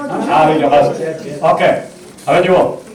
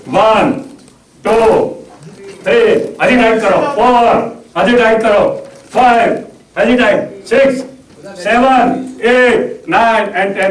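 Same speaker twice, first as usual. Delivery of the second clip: building hypnotic count; 5 semitones higher, slower than usual. Acted. Hypnotist urging volunteers to stiffen like stone, rising commanding count. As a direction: drive each number harder than the last.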